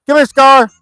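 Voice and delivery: high, loud call to a dog. Real, not acted. Calling the dog over.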